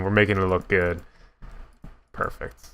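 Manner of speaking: ordinary talk, nothing marked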